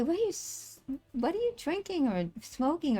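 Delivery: high, soft mock disbelief; a high, hushed what-are-you-on voice; incredulous quote. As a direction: squeak it out in disbelief. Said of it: "I would say".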